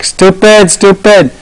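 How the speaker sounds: high, repeated mock echo; imitated echo. The well echoing a boy's taunt, high imitated echo. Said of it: "then the echo comes back".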